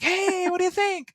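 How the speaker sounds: high, bright host voice; game show host. Retold or imitated. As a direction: call it out like a quiz show host. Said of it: "I sound like a game show host".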